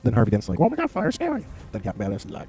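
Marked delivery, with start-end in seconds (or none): 0.6-1.4 very high, panicky falsetto; cartoonish terror, pitched way up; mock scared squeal. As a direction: squeal in hammed-up fright. Mocks Harvey Dent frightened of fire.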